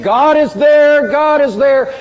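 loud high chant, drawn out slowly; retold shout. Rebels charging the fort, shouting their slogan loud. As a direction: shout it like a battle cry. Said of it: "marched boldly out shouting".

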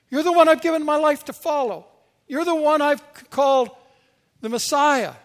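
loud, high-pitched outraged objection; acted disciple, appalled and insistent; upset protest. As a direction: protest in mounting disbelief to a revered master. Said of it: "the more upset he became".

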